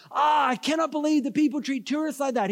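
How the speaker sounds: high-pitched, appalled retold outburst; indignant outburst. Vendor hearing how tourists were cheated, indignant outburst. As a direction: blow up on the tourist's behalf. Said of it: "he blew up".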